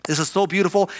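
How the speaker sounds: usual speaking voice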